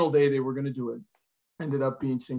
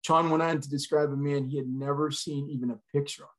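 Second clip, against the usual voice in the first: ordinary talk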